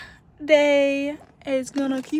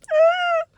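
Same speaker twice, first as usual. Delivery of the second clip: mock high yelp; 8 dB louder than usual. Retold reaction to feeling affected.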